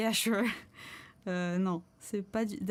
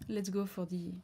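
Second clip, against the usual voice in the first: ordinary talk, nothing marked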